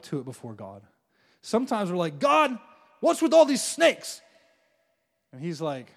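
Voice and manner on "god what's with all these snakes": high, loud, slow indignant whine; mock outraged complaint. People whining to God about the snakes, mock-indignant and loud. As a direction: protest loudly like you're wronged.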